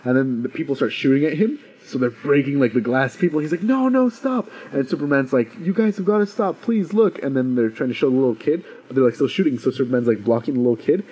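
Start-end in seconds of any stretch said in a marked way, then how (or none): 3.6-4.5 high, pleading no, no, stop; desperate plea. Retold or imitated. Ronnie pleads as tanks shoot. 5.6-7.2 a higher, earnest plea to the soldiers; pleading Superman. Retold or imitated.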